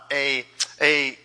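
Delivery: ordinary talk, nothing marked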